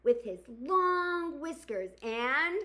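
gentle storybook narration; reading to children. Acted. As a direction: read it like storytime for kids. Reads picture book aloud.

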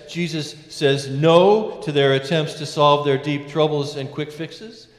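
ordinary talk

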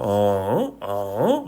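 어 starting low and swinging up; question-mark tone. Shown as a demonstration. Teacher repeating the tone, 어 dipping then rising. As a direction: ask 어 as a question. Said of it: "이런 식으로 읽어요".

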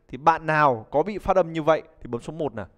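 ordinary delivery

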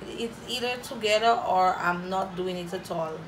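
ordinary talk

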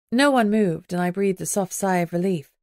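usual speaking voice

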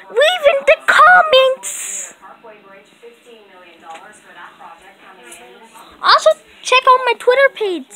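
high, playful cat character; cute kitty voice. Pretend. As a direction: chirp like a sleepy kitty.

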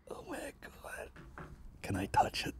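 barely audible, mock-reverent whisper; hushed awe. Visitors walking into the gig room, awestruck whisper. Retold awed visitors at the venue.

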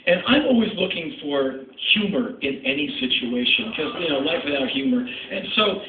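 ordinary talk, nothing marked

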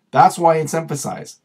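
usual speaking voice

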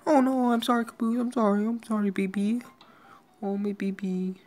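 loud high-pitched fussing over the pet; high distressed apology. Real, not acted. Gamer apologising to her hurt pet in a high loud wail. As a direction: wail your sorry up high.

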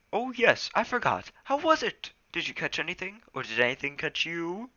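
high, loud cat voice; Smudge voice. Acted. Smudge eager questions.

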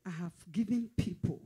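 ordinary talk

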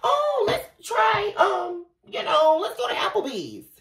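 high, drawn-out eager pitch; lightly hammed-up hopeful partner; hopeful date-night ask. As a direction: coax them sweetly toward a date, high and slow. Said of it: "let's say you".

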